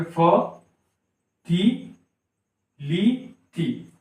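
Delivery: फ, ती, ली, ती each alone, long pauses between; fertility dealt out as फ, ती, ली, ती; four isolated syllables, फ, ती, ली, ती. Shown as a demonstration. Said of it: "चार सिलेबल वाला वर्ड".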